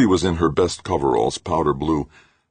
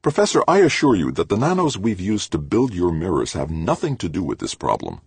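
heated; 6 semitones higher, 3 dB louder than usual. A narrator's reading. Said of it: "With some heat".

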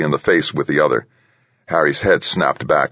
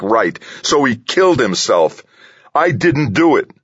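high, heated self-defence; roaring; 7 semitones higher, 4 dB louder than usual. A narrator's reading. Delaney denies killing Pete, roaring in anger. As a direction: rant angrily, pitch pushed up. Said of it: "Delaney roared".

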